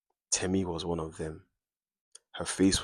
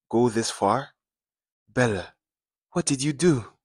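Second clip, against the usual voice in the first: rising higher and louder in alarm; alarmed demand; 5 semitones higher, 5 dB louder than usual. Acted. Mother confronting Bella about her missing daughter, alarmed and loud. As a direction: press her, voice climbing with dread.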